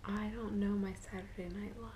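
hushed confession; whispering. Real, not acted. Whispering throughout.

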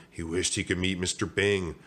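ordinary delivery

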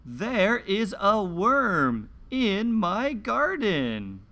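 slow, clear picture-book read-aloud with long pauses; reading to children. Acted. Host reading a garden picture book to young children, slow and clear. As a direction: read each page slowly for little listeners.

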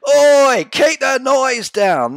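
loud shouted complaint out a window; neighbour yelling. Retold or imitated.

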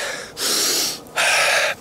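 slow deep breath in through the nose; inhale through nose. Shown as a demonstration. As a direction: draw air in through your nose. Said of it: "good air in through your nose".

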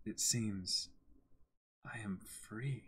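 breathy whisper. Acted. Voicing Griffith's final line as a hushed whisper. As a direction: let it out on a whisper, no voice.

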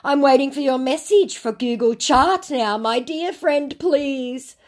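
high, pleading mock scammer. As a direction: ham up the wheedling scammer. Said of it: "And he goes".

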